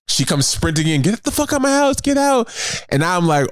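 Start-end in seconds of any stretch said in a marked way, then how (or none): 1.0-2.5 high-pitched mock yelling; retold angry outburst. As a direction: order someone out in a raised pitch. Acting woman storming in, yelling.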